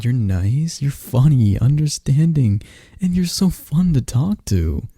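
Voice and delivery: ordinary delivery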